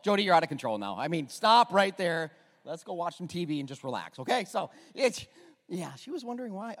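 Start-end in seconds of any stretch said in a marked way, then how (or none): 0.0-4.5 mock alarm. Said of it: "And I was like".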